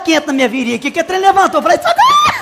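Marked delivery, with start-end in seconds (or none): 1.8-2.4 very high, drawn-out comic shriek; screamed cry for help. Retold or imitated. Client being waxed on the groin, screamed cry for help. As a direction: shriek for help at the top of your range. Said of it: "era um grito que eu dava".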